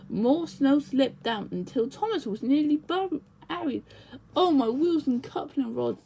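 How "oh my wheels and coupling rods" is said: plain reading voice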